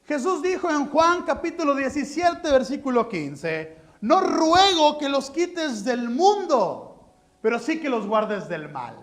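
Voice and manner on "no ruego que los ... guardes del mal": slow, loud, earnest reading of Jesus' prayer; solemn quoted prayer. Acted. Preacher quoting Jesus' prayer, slow and emphatic. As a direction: lean on "No" and draw the line out slowly.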